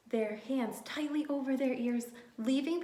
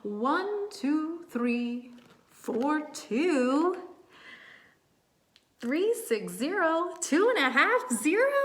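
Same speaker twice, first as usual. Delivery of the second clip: higher, louder, building to a shout; comic bird messing up a count; silly counting; 6 semitones higher, 7 dB louder, slower than usual. A narrator's reading. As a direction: count wildly wrong with gusto. Tacky's wrong silly count.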